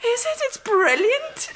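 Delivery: high, amused, laughing disbelief; can't stop laughing at it. Acted. Amused at his poem.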